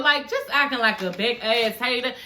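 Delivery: usual speaking voice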